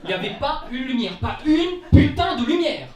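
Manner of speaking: high, loud comic outrage; indignant cry of disbelief; exasperated outburst. Acted. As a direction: build to a loud, stretched-out curse. Comic outrage at finding total darkness.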